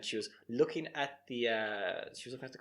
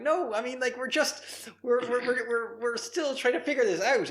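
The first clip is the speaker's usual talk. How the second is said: high, louder mock protest; pleading reassurance; 11 semitones higher, 5 dB louder than usual. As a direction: placate the cook in a high, flustered stammer. Children placating the upset cook.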